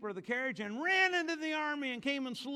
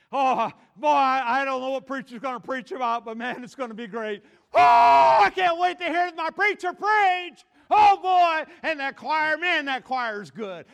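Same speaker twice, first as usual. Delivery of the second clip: loud, whooping eagerness; hammed-up excitement; 11 dB louder than usual. Churchgoer waiting to hear the preacher, whooping excitement.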